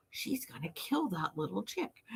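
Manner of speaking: slow, hushed onlooker aside; scandalized bystander whisper. Retold or imitated.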